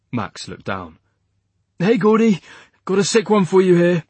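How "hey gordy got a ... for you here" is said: loud, high hail; calling out. Acted. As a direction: shout across to the medic. Calling to the medic.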